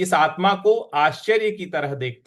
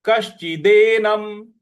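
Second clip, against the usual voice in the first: कश्चिदेनम said slowly, श pressed, louder; slow stressed recitation; 3 semitones higher, 5 dB louder, slower than usual. Shown as a demonstration. Sanskrit teacher drilling students, slow and stressed. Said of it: "उस पर हमको आघात देना है".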